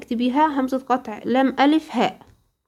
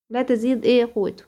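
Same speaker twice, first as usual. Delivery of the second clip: usual speaking voice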